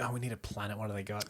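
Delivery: ordinary talk, nothing marked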